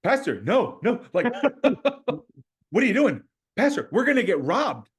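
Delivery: high, loud panicked pleading with a laugh; alarmed plea. Retold or imitated. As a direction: beg him to stop, half laughing in alarm. Panicked plea to pastor in car.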